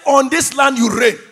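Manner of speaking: loud, insistent repetition; emphatic declaration. Real, not acted. Preacher insisting to the congregation that they reign, loud declaration. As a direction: declare it forcefully to the crowd. Repeats it louder after "I say.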